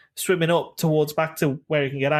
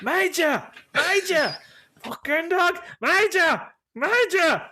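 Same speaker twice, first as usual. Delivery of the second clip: yelling after dog; 12 semitones higher, slower than usual. Retold or imitated. Owner chasing runaway dog down the street, frantic shouting. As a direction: bellow the dog's name down the street. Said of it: "running down the street going".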